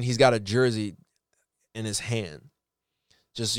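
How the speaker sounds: ordinary delivery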